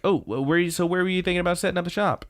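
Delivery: lightly acted keen friend; eager question. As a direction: ask it eagerly, tripping over the start.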